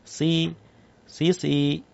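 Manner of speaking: "Si" said thin and light as a plain sin; a light, thin "si" with no heaviness; thin sin demo. Shown as a demonstration. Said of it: "Nah, itu sin".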